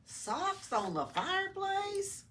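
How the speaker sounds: high, slow astonished lizard voice; Oscar amazed. A narrator's reading. Lizard finds stockings on the fireplace, high and amazed. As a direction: lift it high in disbelief. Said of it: "Oscar said aloud".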